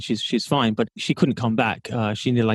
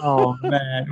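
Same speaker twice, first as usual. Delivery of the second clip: ordinary talk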